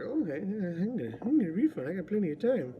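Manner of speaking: high, fast mock-naive voice; a lightly mocking carefree customer; naive viewer. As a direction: play the gullible buyer sure they have time. Mimicking a viewer's naive thought.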